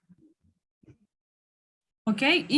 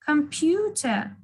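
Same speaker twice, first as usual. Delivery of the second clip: slow Computer with a drawn-out PEW; stress on pew; 2 semitones higher, much slower than usual. Shown as a demonstration. Teacher drilling the stressed syllable, Computer said slowly with stress on PEW. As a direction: stretch out Computer, leaning on PEW.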